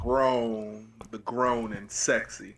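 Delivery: booming late-night radio host. Pretend. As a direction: announce it like a smooth radio DJ. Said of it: "Do I sound like a radio host?".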